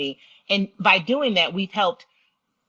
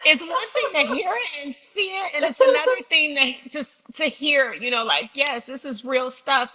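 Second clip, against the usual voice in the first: tearful, voice breaking, higher pitched; choked up while telling the story; crying; 8 semitones higher than usual. Real, not acted. Guest moved to tears talking about girls in Kenya, crying. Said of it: "I didn't mean to cry".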